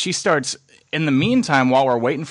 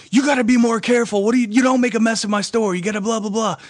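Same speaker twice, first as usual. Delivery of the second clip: higher-pitched retold scolding, hammed up; mock yelling shopkeeper; 8 semitones higher, faster than usual. Shop owner scolding two customers over dropped candy, mock angry high yelling. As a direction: squeal at them like the furious shop lady. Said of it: "starts yelling at us".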